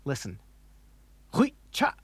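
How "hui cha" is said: "Hui cha" high, each syllable chopped short; abrupt cutoff. Shown as a demonstration. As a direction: stop "cha" dead at the end. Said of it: "has that abrupt cutoff of sound".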